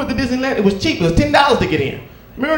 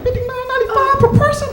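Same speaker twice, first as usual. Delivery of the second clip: pitched way up, incredulous; high disbelief. Retold or imitated. Dad repeating the price to the lady in disbelief. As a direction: squeak the price back.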